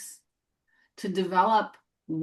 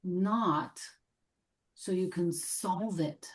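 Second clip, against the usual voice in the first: measured meditation voice, long silences between phrases; gently invite, leaving room after each phrase; guided meditation; 4 dB quieter than usual. Real, not acted. Guide welcoming what needs healing, gentle meditation voice.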